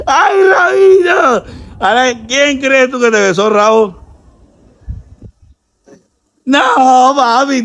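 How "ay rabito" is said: high, slow mock swoon; teasing squeal. Host teasing blindfolded man after a kiss, teasing squeal. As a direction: coo the nickname high and drawn out.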